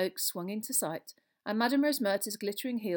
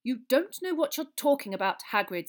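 raised, curt rebuke; sharp; 7 semitones higher, 8 dB louder than usual. A narrator's reading. Minister rebukes the boastful giant, sharply. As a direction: put him sharply in his place. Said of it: "said Fudge sharply".

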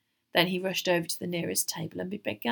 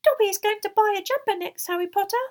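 high, loud, happy elf squeak; cheerful squeaky house-elf; 14 semitones higher, 9 dB louder, faster than usual. A narrator's reading. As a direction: chirp it proudly as a little elf. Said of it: "he said happily".

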